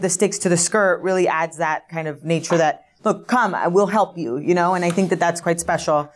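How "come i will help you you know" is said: ordinary talk, nothing marked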